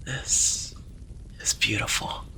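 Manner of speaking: shaky breathless whisper; trembling whisper. Acted. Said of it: "he whispered, voice trembling".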